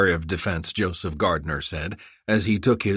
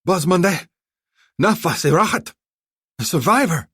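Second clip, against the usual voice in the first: high, loud cry of discovery; calling out in high excitement; excited call; 11 semitones higher, 7 dB louder than usual. A narrator's reading. Azar spots a survivor in the wreckage, high excited cry.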